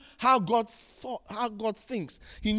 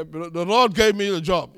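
loud, insistent believer's objection; raised-voice acted insistence; mock protest; 3 semitones lower, 9 dB louder than usual. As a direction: play a believer protesting loudly.

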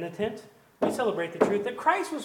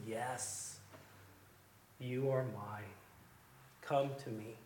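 very soft and lower, tender; tender invitation; 10 semitones lower, 14 dB quieter, slower than usual. Acted. God's last word to the believer, soft and tender. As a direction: whisper God's welcome gently.

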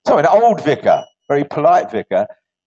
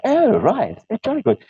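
polite vicar voice; 7 semitones higher than usual. Acted. Vicar answers lady in joke.

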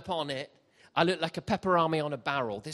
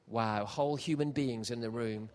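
ordinary delivery

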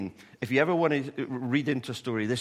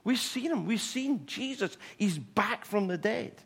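high, breathless joy that slows at the end; women running in to tell the disciples, thrilled; excited news; 8 semitones higher than usual. Retold or imitated. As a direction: burst in with wonderful news. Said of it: "came bursting through saying".

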